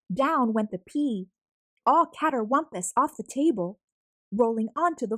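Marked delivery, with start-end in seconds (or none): none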